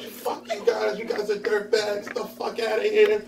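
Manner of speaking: imitating her telling them off; lightly hammed-up angry friend; mock angry rant. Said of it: "the master gator is really coming out".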